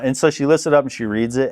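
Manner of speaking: ordinary talk, nothing marked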